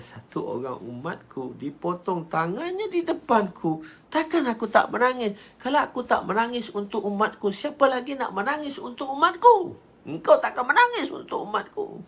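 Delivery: high, rising anguished appeal; acted tearful protest. Prophet explains his tears for his people, anguished appeal. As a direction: build from grief to a high-pitched outcry. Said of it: "Nabi menangis".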